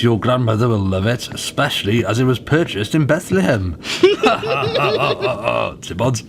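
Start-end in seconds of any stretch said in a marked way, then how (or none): 3.8-5.8 chuckle. Acted. Father chuckles at his purchase.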